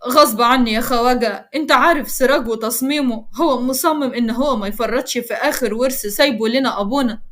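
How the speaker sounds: usual speaking voice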